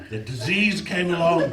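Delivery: ordinary talk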